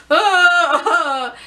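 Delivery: loud mock wail; retold yelp of pain; pained cry. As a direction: cry out as if hurt. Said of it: "I was like".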